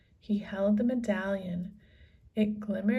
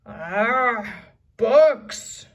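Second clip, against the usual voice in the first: loud, disgusted pirate growl; 2 semitones higher, 11 dB louder than usual. A narrator's reading. Captain unimpressed by books, disgusted growl. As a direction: spit the word out. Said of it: "growled Cap'n Bones".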